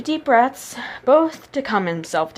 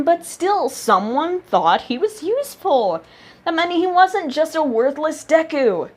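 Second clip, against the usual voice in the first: higher, rising wonder; growing hope; 8 semitones higher than usual. A narrator's reading. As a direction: let hope lift the voice. Realization building.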